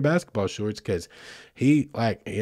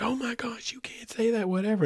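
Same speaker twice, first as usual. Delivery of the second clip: high, hushed mock whisper; embarrassed mom whisper; 11 semitones higher, 5 dB quieter than usual. Night clerk scolds her son for the hair remark, high mock whisper. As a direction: hiss it at the kid, mortified. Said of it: "And she's like".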